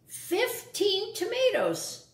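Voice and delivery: high, slow drawn-out order; bossy vermin. Acted. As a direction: stretch out each word.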